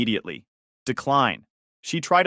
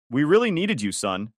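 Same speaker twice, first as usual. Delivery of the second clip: ordinary delivery, nothing marked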